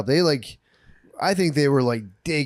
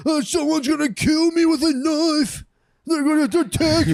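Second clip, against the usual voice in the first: much higher, slow hammed-up panic; mock panicked reporter; 3 dB louder than usual. Mocking reporters who cry danger online, high hammed-up panic. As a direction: whine like a reporter afraid of a knife. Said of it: "They're like".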